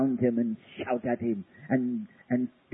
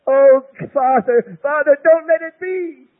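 much higher and louder, a desperate wail; anguished cry; 13 semitones higher, 11 dB louder than usual. Acted. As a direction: cry out Father as if breaking.

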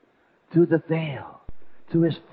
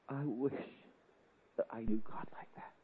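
weeping whisper; 10 dB quieter than usual. Retold or imitated. Weeping alone on the bed.

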